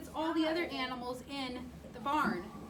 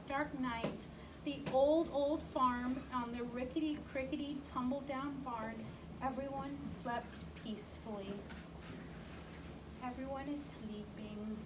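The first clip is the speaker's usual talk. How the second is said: soft bedtime voice; 3 semitones lower, 7 dB quieter than usual. A narrator's reading. Bedtime story ending.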